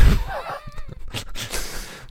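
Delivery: shrill burst of laughter; high squealing laugh. Real, not acted. Stunned at Tucker stat. (hoch quietschendes Lachen)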